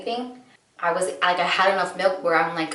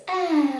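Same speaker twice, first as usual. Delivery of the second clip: put-on high, sweet baby coo sound; imitated baby coo; 11 semitones higher than usual. Mother imitating her baby's sweet sound, high coo. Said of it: "She goes, she goes".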